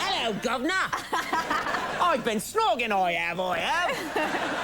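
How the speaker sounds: high, hammed-up Cockney accent; a playful Cockney chimney sweep, Cockney accent; mock Cockney, slow on the end. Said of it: "my chitty chitty bang bang accent".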